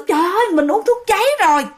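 quick, high gasp of realization; sudden alarm. Retold or imitated. Old man realizing he drank poison, alarmed. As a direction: blurt it out fast as it dawns on him.

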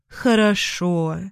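slow, defeated "okay"; resigned sigh. Acted. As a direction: give in with a weary breath. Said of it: "обречённо вздохнула Марина". (обречённый вздох)

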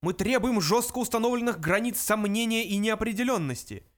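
high-pitched protester's demand; yelling. A narrator's reading. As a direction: shout it like a rally demand. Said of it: "заорал Врумфондель".

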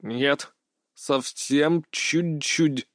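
put-on slow, halting Russian with a foreign accent; soft, laboured mangled-Russian delivery; broken, accented Russian. A narrator's reading. Patient excusing his poor Russian, in halting accented speech. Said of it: "коверкая слова".

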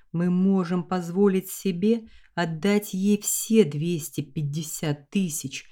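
plain reading voice

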